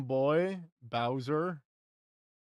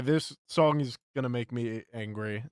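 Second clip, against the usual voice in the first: ordinary delivery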